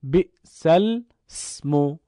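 بئس الاسم read slowly, joined with a kasra as bi'sa-l-ismu; drawn out, each syllable clear; slow demo of بئس الاسم; slow Quranic recitation. Shown as a demonstration. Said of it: "ببینید".

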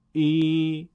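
ای held long and level. Shown as a demonstration. Teacher showing ya maddi beside kasra, prolonged. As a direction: stretch ای over two beats. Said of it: "یاء مدی در دو ضرب".

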